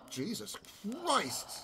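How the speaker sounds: Christ jumps up in pitch; startled, squeaky Christ at the end; odd exclamation. Real, not acted. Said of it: "Why did I say it like that?".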